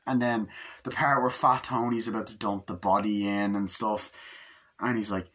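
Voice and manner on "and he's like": usual speaking voice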